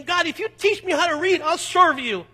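high, loud plea to God; young man crying out to God in desperation, loud plea; desperate cry. Retold or imitated. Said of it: "I cry out of desperation".

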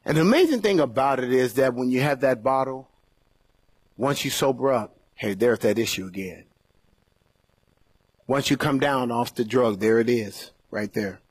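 ordinary talk

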